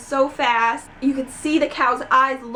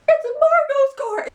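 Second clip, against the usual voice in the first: excited discovery; 12 semitones higher than usual. Retold or imitated. Friends spot the missing car.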